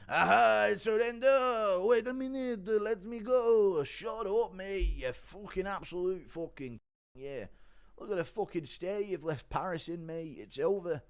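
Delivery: high, loud panicky surrender voice; impression of Garn. Streamer impersonating Garn begging to stop the fight, high and frantic. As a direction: squeal out a frantic surrender, mate. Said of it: "Impression of Garn".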